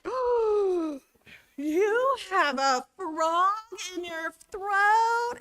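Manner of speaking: high, slow, shocked disbelief; surprised. Retold or imitated. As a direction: gasp it out in horror. Students shocked by idiom.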